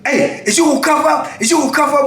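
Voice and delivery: high, insistent sergeant voice; demanding sergeant. Retold or imitated. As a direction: imitate an officer calling out, pitched high. Sergeant orders prisoner to cuff up.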